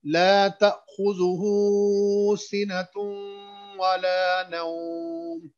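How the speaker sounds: slow melodic chant with long vowels; Quran recitation. Shown as a demonstration. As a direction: stretch each vowel in measured recitation. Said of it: "मैं फिर पढ़ता हूँ".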